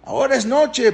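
acted high, insistent appeal; high-pitched pleading. Paró telling children not to worry since it is night, high acted pleading.

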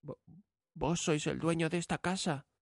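put-on high, soft, stammering young girl; trembling girl voice. A narrator's reading. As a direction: stammer it out, frightened. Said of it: "respondió Bella temblando".

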